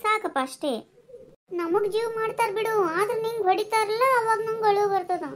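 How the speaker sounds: put-on high, whiny little girl talking to her mother; small child voice. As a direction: pitch it up like a young daughter complaining. (ಎತ್ತರದ ದನಿಯಲ್ಲಿ ಪುಟ್ಟ ಮಗಳು)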